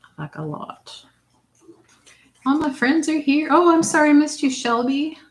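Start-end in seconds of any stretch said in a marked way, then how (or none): 2.4-5.2 high, loud happy greeting; excited greeting. Real, not acted. Greeting viewers in chat.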